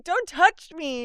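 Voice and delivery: high, slow snooty imitation; mock prissy. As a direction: drag it out like a haughty girl. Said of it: "she's like".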